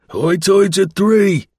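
high, drawn-out shout; calling out loudly. A narrator's reading. Dundas calls from the boat into the fog, calling out loudly. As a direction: call it out slowly across the water. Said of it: "he called out loudly".

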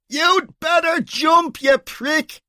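high, slow jeering yell; shouting. Acted. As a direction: heckle him from the crowd at full voice. Heckler yelling from the crowd.

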